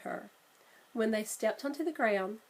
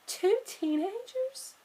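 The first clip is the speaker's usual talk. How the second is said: high, slow, drawn-out question; startled realisation; 12 semitones higher, slower than usual. Acted. Sister realising the pair are the ones they seek, amazed. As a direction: it suddenly clicks.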